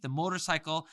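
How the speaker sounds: ordinary delivery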